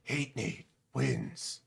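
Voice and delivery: slow, low, hushed game announcer; Mortal Kombat announcer. Acted. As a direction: draw it out softly like a fighting-game victory call. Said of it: "in the Mortal Kombat announcer voice".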